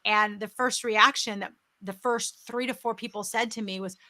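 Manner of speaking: ordinary delivery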